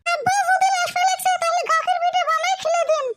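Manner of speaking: high-pitched scolding wife voice; a wife nagging her husband to go shopping. Acted. Wife scolds husband about the in-law's visit, high and nagging. Wife explains pitha plan.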